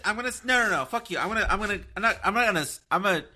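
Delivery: high, stumbling restarts; flustered sputter. Real, not acted. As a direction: trip over your words in protest. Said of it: "flustered sputtering".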